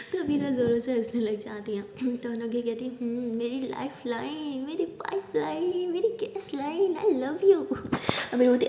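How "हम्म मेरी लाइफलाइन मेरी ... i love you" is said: drawn-out, rising lovey-dovey lilt; teasing, exaggerated sweet talk; playful endearments. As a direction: stretch each pet name, climbing higher. Playful string of pet names.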